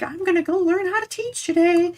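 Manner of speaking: mock-cheerful naive student thought; chipper inner voice. Student on way to class, naive chipper thought.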